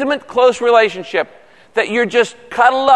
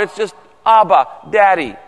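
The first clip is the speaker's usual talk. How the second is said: ordinary talk, nothing marked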